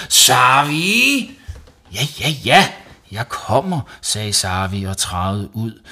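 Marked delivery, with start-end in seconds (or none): 0.1-1.3 high, loud drawn-out name call; impatient yell for the child; angry summons. Acted. Mum calling Savi out, yelling. Mother summons her son once more. 1.9-3.8 high, rising repeated yeses; exasperated compliance. Acted. Savi answering mum's yell, exasperated. Boy answering mother's yelling.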